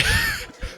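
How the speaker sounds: high squeal. Real, not acted. Laughs at his own act.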